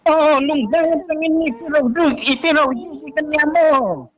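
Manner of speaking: usual speaking voice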